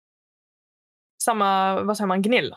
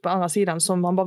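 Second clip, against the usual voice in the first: usual speaking voice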